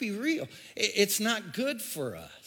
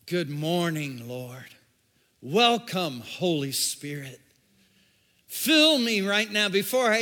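prayer; 3 semitones higher, 6 dB louder than usual. Retold or imitated. A believer praying each morning, building into a loud plea.

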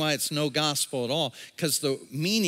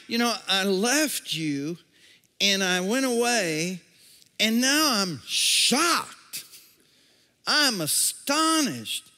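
climbing higher into mock shock; acted astonishment; 4 semitones higher than usual. Pastor voicing Paul to the Galatians, mock shocked. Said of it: "he's like".

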